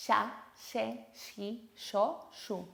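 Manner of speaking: ya, ye, yi, yo, yu with sh onset; sheísmo drill; sh syllables. Shown as a demonstration. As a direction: say each as sha, she, shi, sho, shu. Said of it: "es este sonido".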